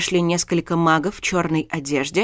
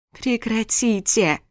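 groaning plea; 5 semitones higher, slower than usual. A narrator's reading. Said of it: "застонала я".

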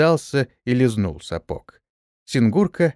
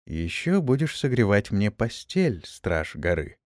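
sultry, growing husky; 2 semitones lower than usual. Acted. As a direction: purr it, sinking into a husk. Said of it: "томным голосом с нарастающей хрипотой".

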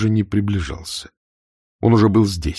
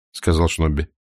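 ordinary delivery

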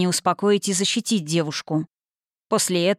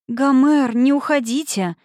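higher, louder cry to stop him; alarmed plea; 6 semitones higher, 4 dB louder, slower than usual. A narrator's reading. She begs the hero not to leave, alarmed. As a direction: call out in sudden alarm. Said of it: "всполошилась она".

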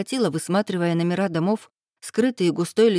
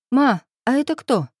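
put-on higher-pitched inquisitive girl; curious child; 6 semitones higher, 4 dB louder than usual. A narrator's reading. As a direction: pipe up with a child's question. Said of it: "поинтересовалась Анюта".

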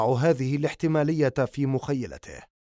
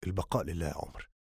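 much lower, much softer, fast and grave; doctor voice; 9 semitones lower, 11 dB quieter, faster than usual. Acted. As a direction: break the news low and quiet. Doctor gives condolences.

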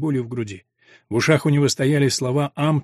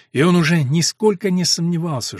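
usual speaking voice

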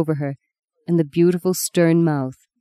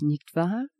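put-on German accent; 9 dB quieter than usual. A narrator's reading. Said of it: "His voice had grown thicker, more German".

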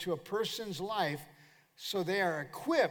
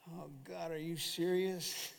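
mock dismay; 5 dB quieter than usual. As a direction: drag it out like a weary complaint to God. Acts out weary believer's complaint.

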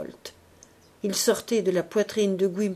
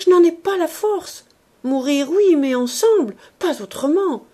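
louder, higher anguished pleading; sobbed plea; 9 semitones higher, 8 dB louder than usual. Acted. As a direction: plead through tears to die together. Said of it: "mais sanglotées".

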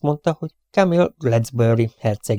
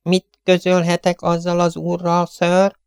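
high, composed servant voice; unruffled butler; 7 semitones higher, slower than usual. Acted. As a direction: butler calmly asks the prince what to say to the visitor, unruffled. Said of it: "olyan hangon, mint akit egyáltalán nem félemlített meg".

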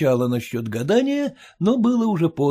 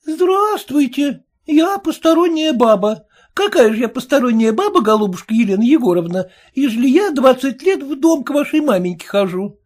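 offended; 9 semitones higher, 4 dB louder than usual. A narrator's reading. Peddler woman offended by the daughter, with hurt pride. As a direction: take it personally, mildly hurt. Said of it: "с обидой в голосе сказала торговка".

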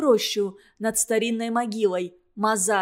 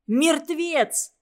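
higher frightened cry; terrified yell; 6 semitones higher than usual. Acted. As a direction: cry it out in panic. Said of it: "завопили нукеры". (испуганный вопль)

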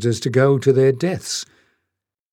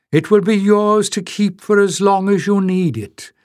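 piper's promise; 9 semitones higher, 3 dB louder than usual. A narrator's reading. Piper making his offer.

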